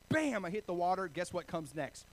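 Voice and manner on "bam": louder, higher shout of Bam; loud impact cry. Retold or imitated. Pastor telling the congregation of hitting the water, loud. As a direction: punch the word like the impact.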